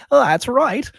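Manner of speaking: put-on high, loud old man's voice; old shopkeeper. Old man confirming to the guard captain, high and loud. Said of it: "the old man's like".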